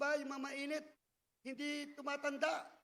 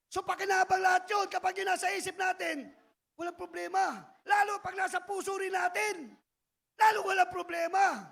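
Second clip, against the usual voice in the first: louder, driving sermon tone; rising preaching; 4 semitones higher, 6 dB louder than usual. Real, not acted. Preacher assuring the church, loud and rising. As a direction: push the point home.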